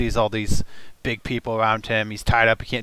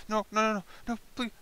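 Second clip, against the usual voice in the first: pleading, pitched up a bit; a lightly whimpering captive; whimpering goblin; 10 semitones higher than usual. Acted. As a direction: beg for your life. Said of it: "shaking and whimpering".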